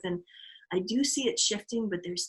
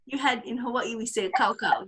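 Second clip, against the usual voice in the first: ordinary talk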